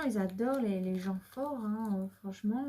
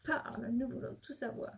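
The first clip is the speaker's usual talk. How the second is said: softer, menacing mock interrogation; mock police interrogator; 6 dB quieter than usual. Reader playing film cop interrogating the card, mock menace. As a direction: grill the card like a film cop, half-joking. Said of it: "l'interrogatoire de police, comme dans les films".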